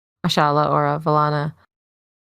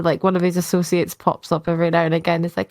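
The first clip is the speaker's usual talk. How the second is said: normal talking voice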